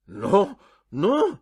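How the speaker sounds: loud, high repeated no; emphatic refusal. A narrator's reading. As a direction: cut in with a high, loud protest. Doctor and patient argue.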